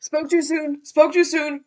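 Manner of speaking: loud, high alarm; startled. Real, not acted. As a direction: blurt it out in a sudden panic. Mobs suddenly appear.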